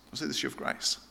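the question breathed out in a soft whisper. Retold or imitated. Repeating the friend's question to the congregation, whispered. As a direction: echo the question almost silently. Friend asks, then echoed.

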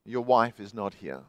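low, soft, hollow echo voice; awful echo. A narrator's reading. The echo answers the lost man, low and soft. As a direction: answer flat and cold from the dark. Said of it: "that awful echo".

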